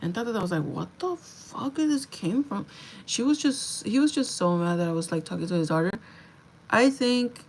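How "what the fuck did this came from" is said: higher, slow baffled outrage; incredulous. Retold or imitated. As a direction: drag it out in disbelief. Baffled by daughter accusation.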